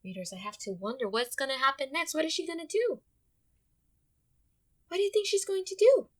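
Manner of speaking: usual speaking voice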